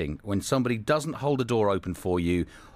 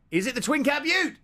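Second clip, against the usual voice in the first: high, hammed-up Aussie accent; squeaky put-on Aussie voice; mock Australian. Host teasing co-host in a high mock Aussie accent.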